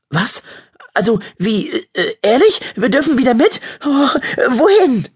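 put-on overwhelmed boy losing his words; boy stunned he may come along; surprised and excited. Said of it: "so überrascht, dass er vor lauter Aufregung".

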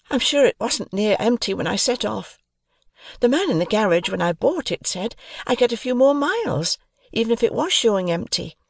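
put-on high, timid young woman; sweet, shy plea for help.

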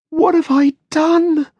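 put-on a boy horrified at what he did; wailing. A narrator's reading. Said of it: "he wailed".

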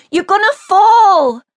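very high, loud, slow alarm; frightened cry. A narrator's reading. Chantel crying out that her mum will fall, scared. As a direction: cry out in fear. Daughter fearing mum falls.

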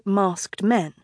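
ordinary delivery

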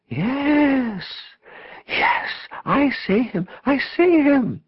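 high, drawn-out exclamations of wonder; breathless-with-awe, high-pitched joy; awestruck cries. A narrator's reading. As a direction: burst out in amazed recognition. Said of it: "awestruck gasp".

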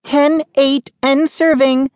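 high, slow, level score call. A narrator's reading. Sister before her serve, calling the score slowly. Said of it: "Mindy finally called out".

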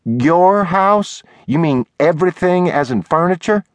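high, slightly put-on woman's voice, astonished; startled female character voice; incredulous assistant. A narrator's reading. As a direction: question it in high-pitched disbelief. Assistant echoes boss in disbelief.

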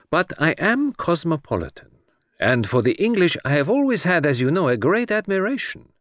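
courteous foreign detective, pitch swinging high; Poirot, proudly cosmopolitan. Acted. Detective professes admiration for the English, courteously proud. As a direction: declare it with polite self-regard.

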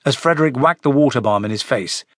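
usual speaking voice

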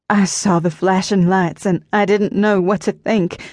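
mildly shaken mother, half-whispered; a touch of a frightened whisper; thin, shaky whisper. A narrator's reading. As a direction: let a little worry thin the voice. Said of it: "she whispered in a thin, shaky voice".

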